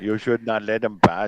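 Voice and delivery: a light take on a stern team principal; Toto Wolff impression. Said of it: "That's my Total Wolf impression for you".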